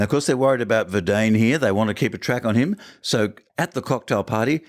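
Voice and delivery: ordinary talk, nothing marked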